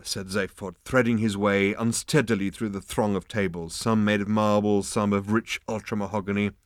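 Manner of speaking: ordinary delivery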